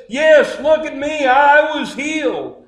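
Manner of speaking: high, emphatic acted assurance; excited testimony. Healed messenger answering doubters, high excited insistence.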